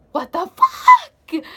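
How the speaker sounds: high, loud, drawn-out disbelief; shocked shout. Retold or imitated. Retelling a witnessed stabbing, shocked shout. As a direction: shriek it, stretching the words out.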